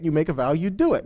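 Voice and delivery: ordinary talk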